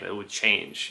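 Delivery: normal talking voice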